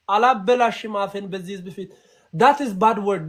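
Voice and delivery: normal talking voice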